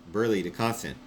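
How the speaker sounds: ordinary delivery, nothing marked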